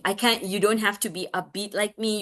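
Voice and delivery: ordinary delivery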